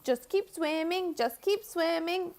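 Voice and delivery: high, slow cheerful Dory voice. Retold or imitated. Streamer doing Dory from Finding Nemo, high and slow. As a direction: lilt it up like Dory. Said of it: "Dory the fish from Nemo".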